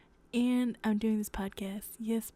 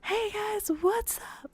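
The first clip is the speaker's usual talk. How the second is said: much higher, bright hey guys greeting; high-pitched upbeat vlog intro, done as a quick demo; usual vlogger greeting; 13 semitones higher, slower than usual. Shown as a demonstration. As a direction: pop up into the peppy YouTuber hello. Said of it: "not my usual".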